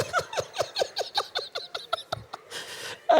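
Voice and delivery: breathy high-pitched giggle; high laugh. Real, not acted. Preacher cracking up at his own story, high laugh. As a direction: burst into a helpless laugh.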